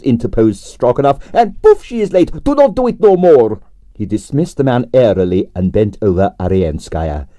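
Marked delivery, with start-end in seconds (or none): 1.3-3.6 fussy impresario. A narrator's reading. Director scolding the newcomer, high and accented. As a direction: scold with a flourish. Stroganov voiced.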